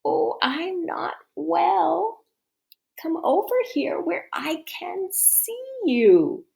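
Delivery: wolf pretending to be sick grandma; hoarse whisper. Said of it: "whispered the hoarse voice".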